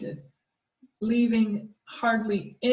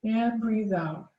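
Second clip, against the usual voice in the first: guided breathing; 4 dB quieter than usual. Acted. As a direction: calmly lead the breathing exercise. Leads a snake breath mindfulness exercise.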